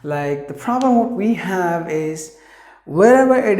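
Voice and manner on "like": ordinary delivery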